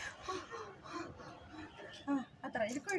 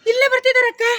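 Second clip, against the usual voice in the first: high, loud projected warning; loud demonstration for an actor; urgent call; 4 semitones higher, 5 dB louder than usual. Shown as a demonstration. Director repeats model line for child.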